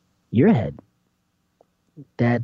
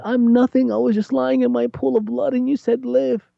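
high, pleading voice; humbled pleader; 10 semitones higher than usual. Retold or imitated. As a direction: beg from the pool of blood.

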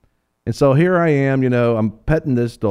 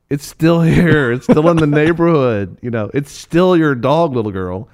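insistent mock scolding; 5 semitones higher, 4 dB louder than usual. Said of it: "And it's like".

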